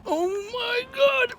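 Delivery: high, drawn-out moan of delight; savouring a chicken baguette, swooning; food bliss. Real, not acted. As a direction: swoon over the taste. Tasting the sandwich.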